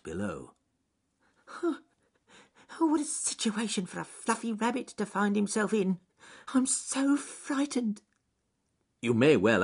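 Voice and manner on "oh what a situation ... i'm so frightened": high, fretful little voice, slowing; frightened rabbit. Acted. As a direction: lament your fix in a scared high pitch.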